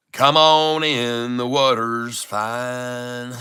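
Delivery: very slow, stretched-out country drawl. Shown as a demonstration. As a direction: hold each word long in a level country twang. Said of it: "Don't let me get my country voice".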